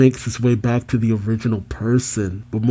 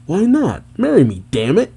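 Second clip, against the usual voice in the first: high, indignant pleading; lightly played angry outburst; mild mock anger; 7 semitones higher than usual. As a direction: act out a petulant demand. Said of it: "Jinny angrily says".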